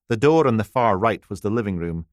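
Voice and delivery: ordinary delivery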